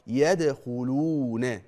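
يدخلون with the waw held two counts; slow correct يدخلون; two-count madd. Shown as a demonstration. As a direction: lengthen the waw of يدخلون evenly. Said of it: "شوف بقرأها إزاي".

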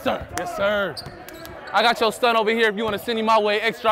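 ordinary delivery

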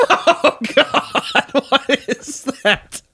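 high, drawn-out imitated dismay; hammed-up revulsion; mock disgust. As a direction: moan it slowly like a revolted dad. Dad waking up to the smell.

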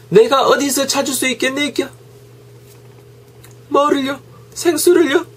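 very high, acted shop dialogue; shop role play. A teacher acting a shopper and clerk in high voices. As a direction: squeak out the shopper's lines.

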